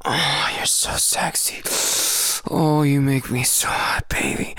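ordinary talk

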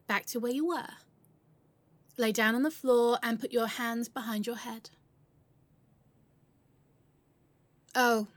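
booming robber addressing hostages; loud commanding. Acted. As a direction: project it over the room.

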